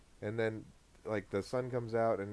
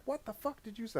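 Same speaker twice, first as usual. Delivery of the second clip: mom's rant, pitched far higher, a bit softer; angry mom; 11 semitones higher, 5 dB quieter than usual. Retold or imitated. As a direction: play the furious mother, high and shrill. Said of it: "She's like".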